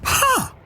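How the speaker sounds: high, short disinterested huh; indifferent huh. Shown as a demonstration. Comic showing the rich response to the news, indifferent high huh.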